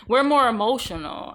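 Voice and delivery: usual speaking voice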